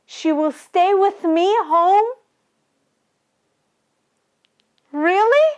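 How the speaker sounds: put-on giddy child telling Granny the good news; overjoyed child. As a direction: burst out with a child's delight. Child overjoyed that mother is home.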